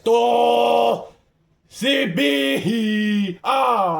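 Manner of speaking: high, loud, held-out cries; excited yelling. Real, not acted. Player crashing into a tree, excited yelling. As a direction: yell in drawn-out bursts. Hit in the game.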